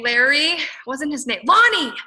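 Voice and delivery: jumps high and loud on Lonnie; sudden recall. Real, not acted. Remembering the recruit's name mid-story, surprised. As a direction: blurt the name as it comes back. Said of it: "That was actually his name".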